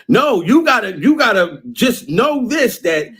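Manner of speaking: usual speaking voice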